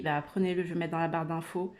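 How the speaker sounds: ordinary talk, nothing marked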